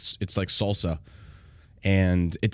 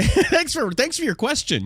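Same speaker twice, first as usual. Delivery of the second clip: much higher, laughing through the thanks; slower than usual. Real, not acted. Thanking a rude listener, laughing. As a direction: laugh it out mockingly.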